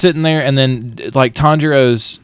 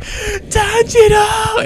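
much higher, drawn-out mock scream; hollering Zenitsu; much slower than usual. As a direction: wail his name, stretched long. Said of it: "screaming and hollering for Tanjiro".

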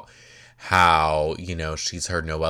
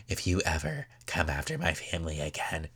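low-key, softer pretend warning; mock threat; 3 semitones lower, 8 dB quieter, faster than usual. [name] warning Nicole off her family, hushed mock threat. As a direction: drop the volume for a mock menacing warning.

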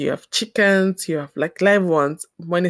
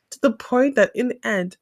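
usual speaking voice

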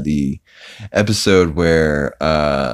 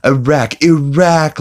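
mock rising shout; 9 semitones higher, 4 dB louder, slower than usual. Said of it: "Look, Lois".

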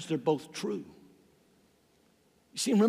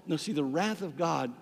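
usual speaking voice

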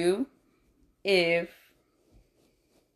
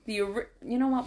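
ordinary talk, nothing marked

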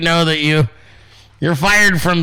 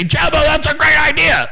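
all-caps yell; 9 semitones higher than usual. Retold or imitated. Streamer recreates his caps-lock replies to Jebba, playful shout. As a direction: bellow it like typing in caps. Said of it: "I just write in all caps to her".

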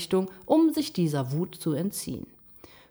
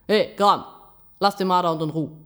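firm raised-voice call; 5 dB louder, faster than usual. A narrator's reading. Raccoon confronts Gram for the raccoon dog, calling out. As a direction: call across to confront him. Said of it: "rief er".

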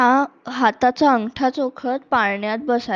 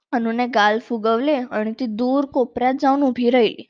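ordinary delivery, nothing marked

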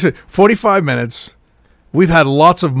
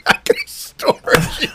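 high, voiceless wheeze of laughter; squeaked out, breath gone from laughing; helpless laughter; 3 dB quieter than usual. Real, not acted. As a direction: repeat it while wheezing with laughter. Echoing the joke, helpless laughter.

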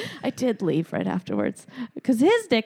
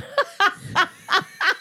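laughing; 12 semitones higher than usual. Real, not acted. Laughing at her own story.

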